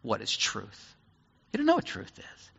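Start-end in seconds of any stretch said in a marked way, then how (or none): 0.0-1.0 a soft, dismissive pfft; quietly scoffing Pilate. Retold or imitated. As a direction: brush the question off with a scoff. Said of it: "And Pilate just goes".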